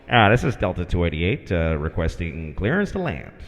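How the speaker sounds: low, level cockpit radio voice; pilot on the radio. Retold or imitated. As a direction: request clearance as a calm airline pilot. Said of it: "we keep hearing our guy trying to get clearance".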